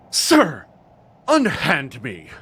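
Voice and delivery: loud, high, outraged command; theatrical affronted father, very loud; indignant shout. Acted. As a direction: shout it as you yank free of a grab. Yanking away when grabbed.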